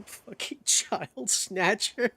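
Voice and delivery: laughing through the words; wheezing laugh at the joke. Real, not acted. Streamer repeats a game line while cracking up.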